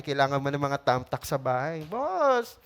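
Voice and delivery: normal talking voice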